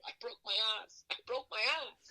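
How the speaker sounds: high, strained yell of pain. Retold or imitated. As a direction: cry out clutching a hurt tailbone. Holding his tailbone in pain.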